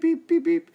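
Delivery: high, loud beep beep beep; squeaky imitated smoke alarm beeps. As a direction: mimic the alarm chirping, high. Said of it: "heard it go beep".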